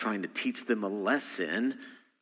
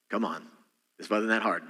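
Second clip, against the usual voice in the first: ordinary talk, nothing marked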